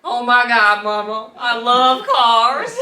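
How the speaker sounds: loud, slow, hammed-up Southern American accent; playful over-the-top Southern American accent. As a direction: belt it out in a big Southern drawl. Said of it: "I love doing the Southern accent".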